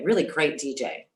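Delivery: usual speaking voice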